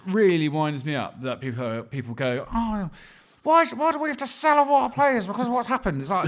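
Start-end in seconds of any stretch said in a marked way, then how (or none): 2.5-6.0 high, laughing whiny fan; mock moaning fan. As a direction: whine it like a sulking fan. Mocking complaining Villa fans.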